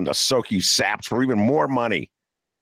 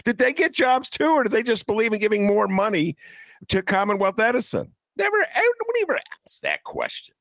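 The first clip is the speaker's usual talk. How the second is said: indignant rising rant; 13 semitones higher than usual. Real, not acted. Indignant rhetorical questions.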